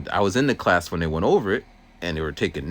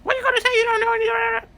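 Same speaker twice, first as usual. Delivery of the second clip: much higher, louder scornful yell; yelling teacher; 6 dB louder, faster than usual. Retold or imitated. Harsh teacher yelling at the new student, shrill and scornful. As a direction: bark at the kid like a harsh teacher. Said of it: "why would I yell at her".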